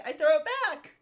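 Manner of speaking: excited. Real, not acted. Repeats action with excitement.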